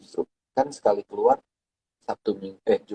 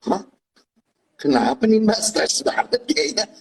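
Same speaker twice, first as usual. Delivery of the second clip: high-pitched mock greeting; high voice of a busybody; acted high quote; 12 semitones higher, slower than usual. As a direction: play the nosy neighbour, pitched up. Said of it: "langsung kayak".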